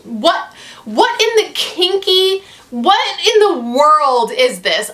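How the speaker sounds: high, slow, disbelieving what-is-this; incredulous outburst. Real, not acted. Vlogger reacting to the beast scene, slow shocked disbelief. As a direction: stretch it out in shocked disgust. Outraged at the book.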